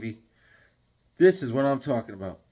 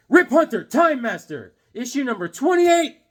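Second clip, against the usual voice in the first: high, loud triumphant reveal; excited announcement; 9 semitones higher, 5 dB louder than usual. Real, not acted. Vlogger revealing his comic find to viewers, excited proclamation. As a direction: proclaim each part like a big unveiling.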